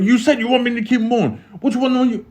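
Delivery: high-pitched exasperated objection; exasperated protest. Retold or imitated. Cellmate objecting to guards' contradictory strip orders, exasperated.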